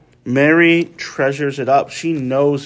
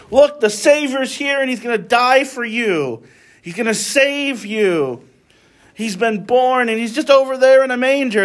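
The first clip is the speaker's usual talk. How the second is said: excited shepherd; 10 semitones higher than usual. Acted. Shepherds telling strangers the news.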